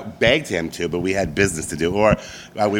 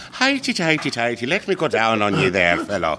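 Irish accent; 3 semitones higher than usual. Shown as a demonstration. Guest asked to show an Irish accent, hammed-up Irish. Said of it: "How would you do an Irish accent?".

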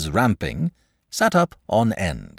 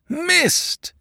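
crowd's yell. A narrator's reading. Crowd reacting to the first charge, high mock yell. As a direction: call it out high, like a watching crowd. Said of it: "yelled the crowd".

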